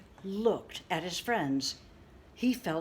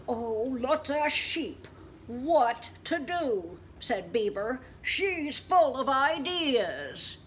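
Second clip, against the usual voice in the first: puzzled beaver; 5 semitones higher, 5 dB louder, slower than usual. Acted.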